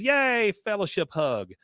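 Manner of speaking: high, loud mock squeal of delight; hammed-up girlish excitement; gleeful cheer. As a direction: cheer it like an over-keen youth leader. [name] cheers for a group hug.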